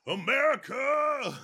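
slow drawn-out shout; patriotic shout. Pretend. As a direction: bellow it like a rally chant. Mock patriotic chant.

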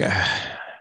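long unvoiced sigh before giving in; reluctant sigh. Son grudgingly answering his estranged father, reluctant sigh. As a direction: let out a soft, reluctant breath.

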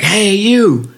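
high, loud hail; calling out. Retold or imitated. Old veteran hailing the runner on the shoulder, loud high call. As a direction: call him over from the road.

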